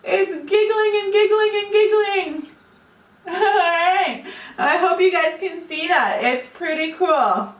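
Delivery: bubbly delighted commentary; exaggerated cartoon intonation. Real, not acted. Foam still erupting.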